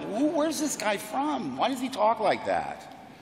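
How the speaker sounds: put-on high, puzzled kid's question; baffled and curious, pitch jumping high; puzzled wonder. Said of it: "You know, I thought".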